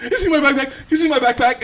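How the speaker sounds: high, loud, panicked question; retelling his panicked call for his lost backpack, high and loud; frantic calling out. Retold or imitated. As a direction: shout it while searching desperately.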